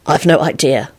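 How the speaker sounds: usual speaking voice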